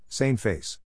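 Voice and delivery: plain reading voice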